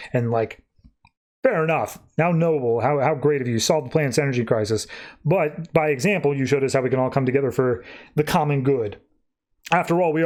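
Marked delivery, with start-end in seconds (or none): none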